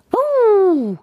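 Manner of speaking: high loud happy yelp; high playful dog bark; excited dog yelp. Acted. Dog Max jumps excitedly.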